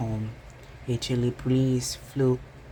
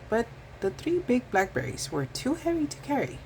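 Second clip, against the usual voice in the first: usual speaking voice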